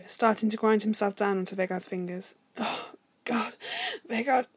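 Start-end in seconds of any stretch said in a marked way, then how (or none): 2.6-4.6 higher, breathy gasps between words; breathless, broken-up moan; panting. A narrator's reading. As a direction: pant it out, ending on a high sigh. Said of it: "he panted".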